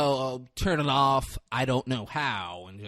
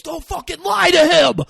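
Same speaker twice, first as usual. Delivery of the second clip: much higher and louder angry yell; furious shout; 10 dB louder than usual. Retold or imitated. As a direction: scream it at her in outrage.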